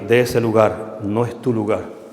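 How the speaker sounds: low, measured command, lightly voiced as God; voz of God. God telling someone to leave the group, lightly voiced, low and solemn. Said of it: "Y Dios le va a decir a alguien de ese grupo".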